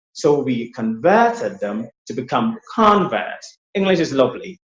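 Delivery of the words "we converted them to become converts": conVERTed stressed on VERT, then CONverts on CON; verb against noun stress. Shown as a demonstration. Teacher contrasting both forms in one sentence, converted and converts stressed differently. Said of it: "look at the verb form".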